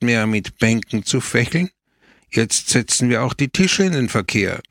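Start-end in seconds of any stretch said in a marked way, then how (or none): none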